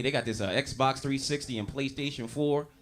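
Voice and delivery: ordinary delivery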